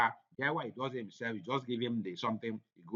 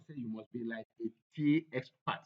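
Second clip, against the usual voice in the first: normal talking voice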